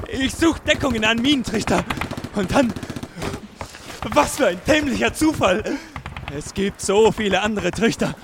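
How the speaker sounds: ordinary talk, nothing marked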